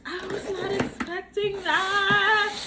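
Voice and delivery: loud, high, drawn-out wail of disbelief; overwhelmed surprise. Real, not acted. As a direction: burst out, overcome with happy shock. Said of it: "I'm gonna cry".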